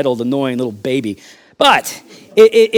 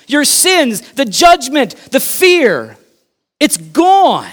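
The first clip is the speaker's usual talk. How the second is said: loud, higher, emphatic sermon peak; full-force preaching; 5 semitones higher, 3 dB louder than usual. Acted. Pastor proclaiming "It is finished" to the church, loud and fervent. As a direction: drive each line home to the congregation.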